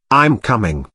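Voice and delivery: ordinary delivery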